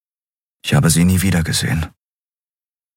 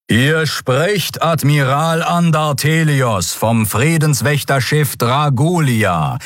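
higher, slow, formal voice of the admiral; booming admiral announcement; 8 semitones higher, slower than usual. Acted. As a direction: proclaim it over the whole boulevard. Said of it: "dröhnte die dunkle, kräftige Stimme". (der Admiral verkündet der Stadt, förmlich und getragen)

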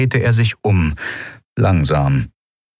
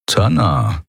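thoughtful repeat. A narrator's reading. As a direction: turn the name Tanna over in the mind. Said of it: "wiederholte er".

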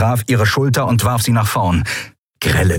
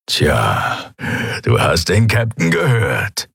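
growly rumble; slower than usual. A narrator's reading. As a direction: rumble it like a menacing henchman. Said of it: "brummte er".

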